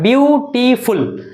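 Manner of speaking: "ब्यूटीफुल" split slowly into its three syllables. Shown as a demonstration. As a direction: break "ब्यूटीफुल" into three slow beats. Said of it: "ब्यूटीफुल बोले तो तीन बार में". ("ब्यूटीफुल" को तीन धीमे हिस्सों में तोड़कर)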